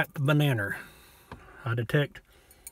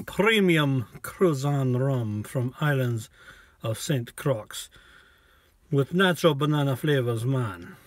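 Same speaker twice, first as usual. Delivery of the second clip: slow, laid-back mock Jamaican accent; hammed-up Jamaican accent, unhurried. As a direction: drawl the label out like a Jamaican rum ad. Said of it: "but I like doing the accent".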